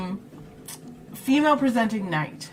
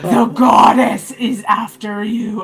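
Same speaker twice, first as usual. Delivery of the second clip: announcing voice; 8 dB louder than usual. Acted. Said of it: "you're walking out like".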